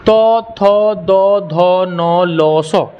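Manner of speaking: held तो, थो, दो, धो, tongue striking the teeth; drawn-out dental syllables तो, थो, दो, धो, नो, लो, सो; dental sound drill. Shown as a demonstration. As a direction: chant तो, थो, दो, धो evenly for the class. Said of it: "दांत पर आपका जीभ बार बार टकराएगा".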